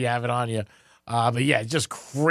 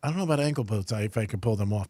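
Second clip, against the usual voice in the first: ordinary talk, nothing marked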